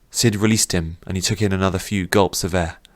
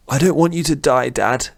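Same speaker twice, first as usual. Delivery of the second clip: desperate plea; 7 semitones higher than usual. Acted. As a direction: beg him not to die. Son begging dying father.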